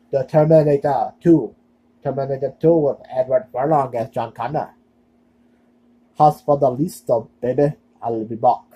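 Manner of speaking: put-on amateur Schwarzenegger-style Austrian accent; bad Austrian accent. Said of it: "Sorry, I had that bad, bad accent".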